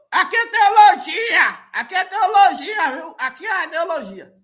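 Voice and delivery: mocking imitation. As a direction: stretch "teologia" out and ham it up to mock him. Zombando da frase do pastor. (imitação debochada, mais alta e mais aguda)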